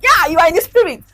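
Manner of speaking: high, loud and fast, cheering her on; excited husband. Retold or imitated. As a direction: hype up the wife's plan, quick and loud. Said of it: "My husband was like".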